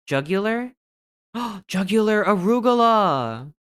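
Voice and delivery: gasping sudden high exclamation of discovery; excited outburst. Real, not acted. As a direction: blurt it out as if struck by genius. Excited word guess discovery.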